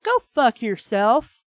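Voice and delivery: slow, loud fed-up curse; angry outburst. Real, not acted. Reader cursing at the hero in the novel, angry. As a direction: spit it at the book.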